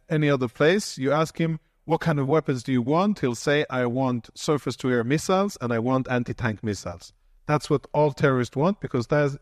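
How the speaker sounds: ordinary delivery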